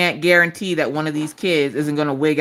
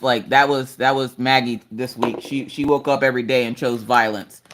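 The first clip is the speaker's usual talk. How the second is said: ordinary talk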